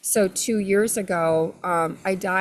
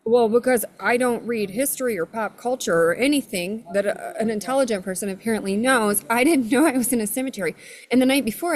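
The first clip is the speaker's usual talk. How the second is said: ordinary delivery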